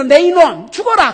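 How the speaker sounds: loud, high threatening cry; quoting a curse on Jonah, loud and high; loud mock threat. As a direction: bellow it like a furious master. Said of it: "그러고 죽였어요".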